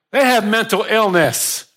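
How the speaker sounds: high, loud sneering scoffer; mocking scoffer. Preacher voicing a scoffer, loud and high. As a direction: jeer it out like a mocker. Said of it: "We're always gonna get that".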